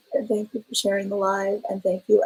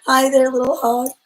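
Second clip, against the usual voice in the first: saucy voice; 4 semitones higher, 6 dB louder than usual. Pretend. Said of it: "we've got the saucy voice going on today".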